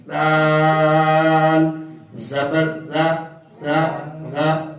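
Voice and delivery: دال زبر دا chanted very slowly and gently; نرمی سے دا; soft da. Shown as a demonstration. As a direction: stretch each syllable softly. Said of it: "نہ سیٹی نہ سختی".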